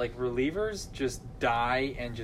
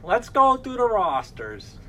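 loud, slow, hammed-up teasing of rosters; mock drawn-out rosters; 10 semitones higher, 10 dB louder, slower than usual. Teasing his co-host's "rosters", loud and slow, hammed-up.

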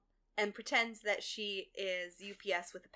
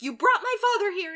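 high, loud mock panic; panicked yell; 10 semitones higher, 9 dB louder than usual. Neil discovering Emma brought his father, acted panic. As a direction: cry it out in hammed-up alarm. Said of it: "Neil panics and is like".

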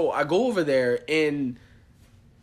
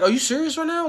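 disbelief; 11 semitones higher, faster than usual. Retold or imitated. Speaker's outraged retold disbelief.

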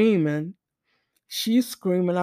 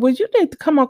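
higher, louder prodding quote; 7 semitones higher, 5 dB louder, much faster than usual. Retold or imitated. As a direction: imitate an impatient passenger prodding. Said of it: "because she kept prodding".

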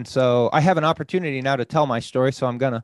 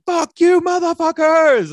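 defiant yell; 5 dB louder, slower than usual. Retold or imitated. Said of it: "You were fucking".

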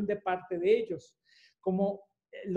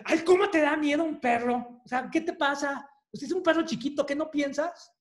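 mocking dad; 7 semitones higher than usual. Dad mocks child's fear.

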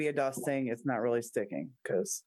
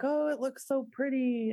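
higher-pitched cooing delight; retold wonder; 10 semitones higher than usual. Retelling her reaction to snowfall at work, delighted. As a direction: act out admiring something pretty.

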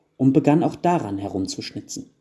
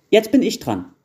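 put-on much higher, louder child voice; eager little girl; 10 semitones higher, 5 dB louder than usual. A narrator's reading. Middle elf girl claiming her turn, eager. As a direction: jump in to claim your turn. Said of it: "sagte der mittelgroße Wichtel".

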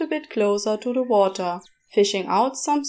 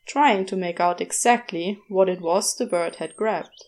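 ordinary delivery

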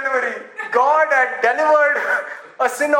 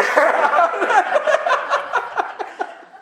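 laughter; 8 semitones higher than usual. Real, not acted. Laughs at own joke.

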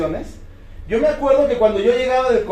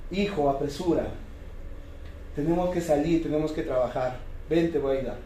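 gentle father; 5 semitones lower, 9 dB quieter than usual. Retold or imitated. Imagined kind father.

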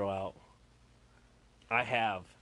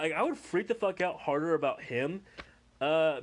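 ordinary talk

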